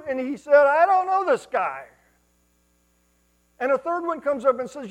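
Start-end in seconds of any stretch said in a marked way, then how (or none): 0.7-1.9 loud denial. Acted. Peter denies loudly.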